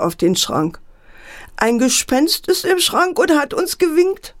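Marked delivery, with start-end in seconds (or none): 1.6-4.3 put-on frightened child. A narrator's reading. Frightened child reports ghost.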